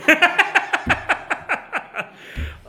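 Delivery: long, loud, high peal of laughter; hearty laugh. Real, not acted. Preacher amused at people still wanting a king, hearty laugh. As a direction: burst out laughing at the absurdity.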